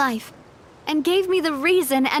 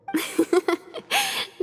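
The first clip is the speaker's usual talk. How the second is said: a short bright giggle, higher pitched; playful laugh; 6 semitones higher than usual. Real, not acted. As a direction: laugh at your own joke, teasingly. Joking about floating away.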